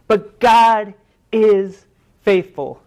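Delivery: slow, loud, every word weighed; emphatic proclamation. Acted. Preacher assuring the congregation, slow and emphatic. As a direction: lean hard on faithful. Preacher stresses God's faithfulness.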